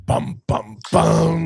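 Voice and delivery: sung-out mock suspense motif; dramatic sting. Ominous reveal of the evil temple, mock dramatic sting.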